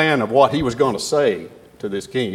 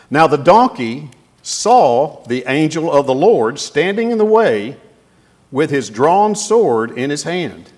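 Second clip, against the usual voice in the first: raised, measured Bible reading voice; scripture reading; 3 semitones higher, 4 dB louder than usual. Acted. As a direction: read the passage like a preacher in full flow. Preacher reading scripture aloud.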